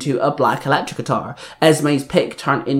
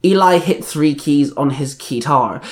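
ordinary delivery, nothing marked

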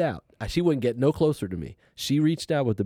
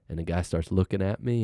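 usual speaking voice

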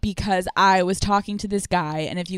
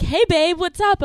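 jolly guy; 10 semitones higher, 4 dB louder than usual. Retold or imitated. Imitating the guy's jolly call.

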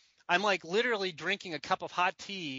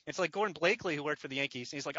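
normal talking voice

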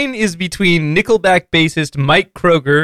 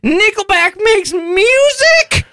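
put-on much higher, slow dopey man-child voice; Ralph voice; slower than usual. [name] as Ralph, asking his dad in a slow, high dopey voice. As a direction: ask it slowly, like a clueless slacker. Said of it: "my Ralph voice".

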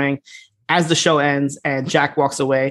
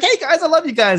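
much higher, gushing mock excitement; all excited; 12 semitones higher than usual. Big Jim hugging the brawling wrestlers, gushing high. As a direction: burst out like an overjoyed big guy. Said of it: "all excited".

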